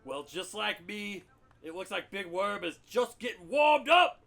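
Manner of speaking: gruff bellowing army colonel; Frank Slade. Acted. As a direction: roar it like a blind old colonel. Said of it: "Lieutenant Colonel Frank Slade".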